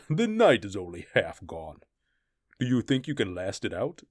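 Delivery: lightly voiced mocking brute; gloating barbarian. Thord gloats at his captive through the long night, lightly mocking. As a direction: gloat over the prisoner. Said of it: "Thord laughed".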